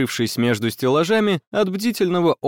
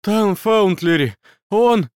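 frightened; 7 semitones higher, 4 dB louder than usual. Acted. Panicked student reporting attack.

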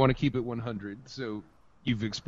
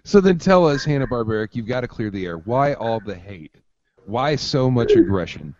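mock interrogation; 11 dB louder than usual. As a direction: lean in like a tabloid host.

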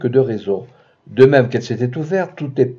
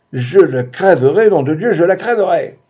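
enraged threat; 5 semitones higher, 4 dB louder than usual. Acted. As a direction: spit the threat in blind rage. Enraged husband at door. (menace furieuse, lente et forte)

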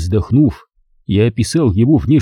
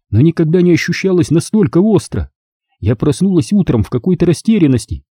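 put-on troubled older woman, higher pitch; 6 semitones higher than usual. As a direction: recall a puzzling loss gently. Leonora's voice.

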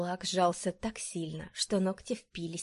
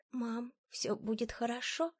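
put-on soft, higher child's voice; quiet question; 5 semitones higher, 5 dB quieter than usual. A narrator's reading. Small son asking his mother for comfort, quiet. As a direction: ask softly and a little higher. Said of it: "тихо спросил он".

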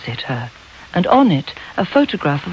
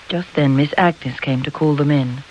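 ordinary delivery, nothing marked

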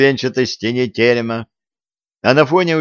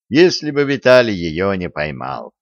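plain reading voice

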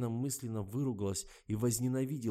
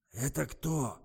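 barked; 5 semitones higher, 3 dB louder, slower than usual. A narrator's reading. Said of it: "гаркнул старик".

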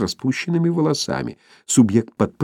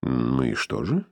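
stern question; 8 semitones lower, 6 dB quieter than usual. A narrator's reading. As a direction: quiz him like a strict doctor. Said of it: "строго спросил".

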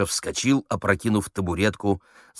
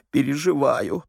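emotional actress; 7 semitones higher than usual. Acted. Actress still trembling after role.

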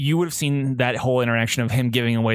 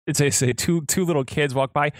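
ordinary delivery

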